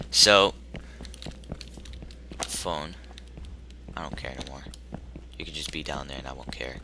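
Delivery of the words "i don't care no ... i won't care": low defiant whisper; low whispered shrug. Real, not acted. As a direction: hush it out, brushing the monster off. Sneaking past, defiant.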